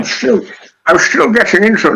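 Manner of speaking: high, slurred attempt at a Scottish accent; bad Sean Connery. Player warming up for a quip, bad Sean Connery accent. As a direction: try out a slushy, hammy Scottish accent. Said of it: "bad Sean Connery accent".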